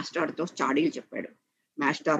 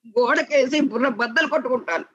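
put-on higher-pitched child's outburst; acted shouted threat; 7 semitones higher, 4 dB louder than usual. A narrator's reading. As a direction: shout it like a boy making a desperate threat. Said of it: "అని ఇంకా గట్టిగా అరిచాడు".

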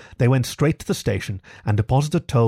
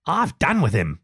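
higher-pitched, understated anger; savage; 4 semitones higher than usual. A narrator's reading. Rat washing his hands of Toad, mildly savage. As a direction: dismiss him with mild savagery. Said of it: "said the rat savagely".

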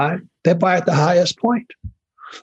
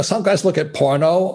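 ordinary talk, nothing marked

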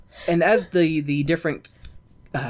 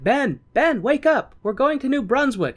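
high, urgent mock wake-up call; play-acted calling out; calling someone awake; 10 semitones higher than usual. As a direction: imitate rousing a sleeper with raised pitch. Adams waking Franklin, acted out.

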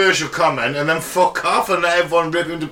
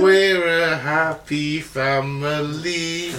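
dystopian announcer; much slower than usual. Pretend. Mock propaganda voice.

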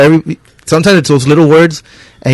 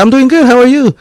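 cheery customer; 11 semitones higher, 3 dB louder than usual. Retold or imitated. Voicing a friendly customer.

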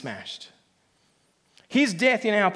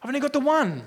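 higher, urgent and worried; nervous father; 4 semitones higher than usual. Acted. Nervous father urging the confident one, higher and urgent. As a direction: press the point anxiously. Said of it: "The nervous one says to the confident one".

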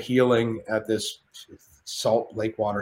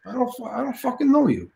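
high put-on De Niro; De Niro impression; 12 semitones higher than usual. De Niro brushing off Shane in the hallway, put-on voice. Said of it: "He looks at me and goes".

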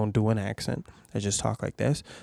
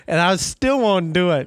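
ordinary talk, nothing marked

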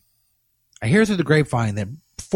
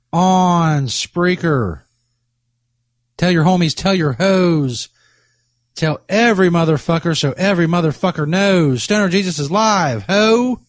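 higher, rhythmic bragging call-out; hype shout-out; 5 semitones higher, 3 dB louder than usual. Pretend. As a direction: pump up the crowd like a DJ.

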